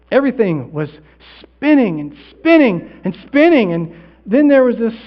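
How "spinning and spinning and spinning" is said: slow, drawn-out swoops, higher pitched; higher, slow and swirling; dizzy, reeling. Acted. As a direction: stretch each repeat like the room is reeling. Acting out dizziness before death.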